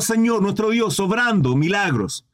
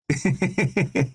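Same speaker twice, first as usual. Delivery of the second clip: soft chuckle; 5 semitones lower, 3 dB quieter than usual. Real, not acted. Laughs mid-sentence.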